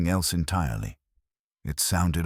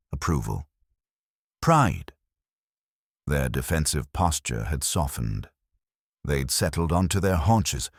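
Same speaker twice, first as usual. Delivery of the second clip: usual speaking voice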